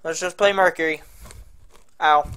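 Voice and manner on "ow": crunching chips. Real, not acted. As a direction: mouth full of pretzels. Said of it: "Sorry, I'm eating pretzels".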